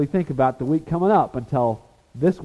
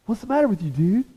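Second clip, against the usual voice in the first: casual Jesus; 9 semitones higher, faster than usual. Retold or imitated. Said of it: "He was probably like".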